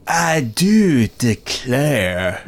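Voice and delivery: slow, drawn-out Southern drawl; mock southern accent. Mocking Keanu's shaky accent, slow Southern drawl. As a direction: ham up a Southern belle accent. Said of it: "that southern accent".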